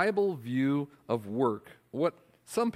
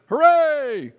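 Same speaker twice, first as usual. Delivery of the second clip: high, loud crowd cheer; shouted hooray; 11 semitones higher, 10 dB louder than usual. Acted. Writers cheering the deal, loud high shout. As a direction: cheer like a whole union hall. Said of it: "they all shouted".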